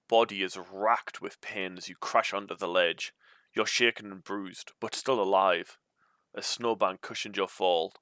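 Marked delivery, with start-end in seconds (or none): none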